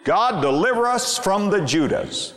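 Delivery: usual speaking voice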